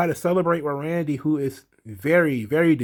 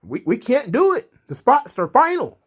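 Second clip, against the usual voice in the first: higher, louder bossy manager voice; mock official brush-off; 10 semitones higher, 4 dB louder than usual. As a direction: wave the complainers off as the boss. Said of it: "And they're like".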